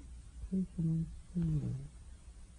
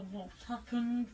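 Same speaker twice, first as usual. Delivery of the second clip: ordinary talk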